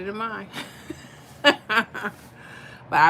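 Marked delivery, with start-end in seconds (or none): 1.4-2.1 quick bright burst of laughter; short laugh. Real, not acted. Laughing after thanking a viewer for the compliment.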